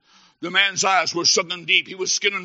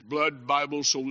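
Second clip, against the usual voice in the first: ordinary talk, nothing marked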